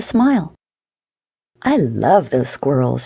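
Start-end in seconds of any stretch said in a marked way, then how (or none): 1.6-3.1 low, slow musing to oneself; thought to himself. A narrator's reading. Man thinking of the squirrels, low slow inner musing. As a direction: savour it slowly, pitched down. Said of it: "he thought to himself".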